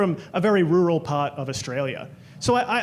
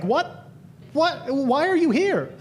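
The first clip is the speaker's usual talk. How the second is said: mock startled; 6 semitones higher, slower than usual. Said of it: "I was like".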